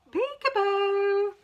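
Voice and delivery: spring it on them like the game; playful peekaboo. Acted. Playful peekaboo line to toddlers.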